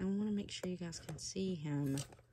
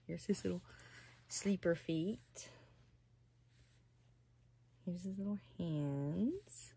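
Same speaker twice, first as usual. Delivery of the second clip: whisper; 5 dB quieter than usual. Real, not acted. Revealing the doll tenderly.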